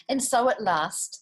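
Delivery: usual speaking voice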